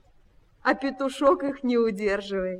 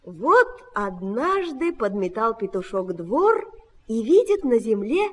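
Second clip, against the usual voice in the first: plain reading voice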